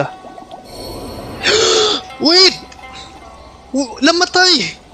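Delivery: usual speaking voice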